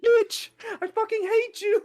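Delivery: very high, squealed mock outburst; mock fury. Viewer reacting to Kawaru's death, very high hammed-up fury. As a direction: wail hatred in a far-up pitch.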